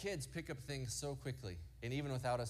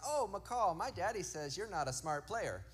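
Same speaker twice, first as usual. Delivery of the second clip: put-on little boy; 6 semitones higher than usual. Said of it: "And he goes".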